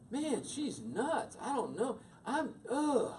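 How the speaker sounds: high-pitched frustrated grumble; worked-up kid venting; exasperated teen. Retold or imitated. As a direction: throw up your hands at mom, ending in an ugh. Voices his frustrated teenage self.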